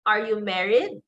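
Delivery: "Are you married" climbing high on MARRIED; rising intonation. Shown as a demonstration. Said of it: "you give a higher pitch".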